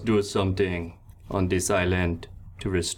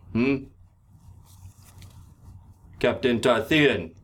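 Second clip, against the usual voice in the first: captain granting Riley his ship; commanding pirate captain; 4 dB louder than usual. Acted. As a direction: declare it to the whole crew. Gale grants command loudly.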